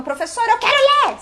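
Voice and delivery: put-on high, loud, childish demand; a student insisting on reading. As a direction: blurt it out like a pushy student. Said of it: "não precisa ser assim".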